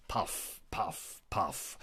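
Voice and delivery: soft breathy puff sounds; cigar puffs. Pretend. Royal puffing on a cigar, soft puffs.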